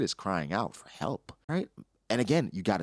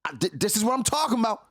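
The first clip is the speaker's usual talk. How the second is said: higher, louder exasperated protest; mock exasperated yell, pitched up; frustrated dude; 12 semitones higher, 4 dB louder, faster than usual. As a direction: throw up your hands at a kid who won't listen. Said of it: "And the dude's like".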